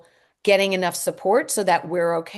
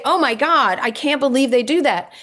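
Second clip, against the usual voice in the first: higher, louder shocked gasp; mock outrage; 5 semitones higher, 5 dB louder than usual. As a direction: gush with shocked sympathy for the child. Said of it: "If you say".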